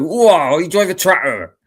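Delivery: put-on high, loud teasing accent; accent impression of Martin. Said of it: "like, Martin, you come on here and go".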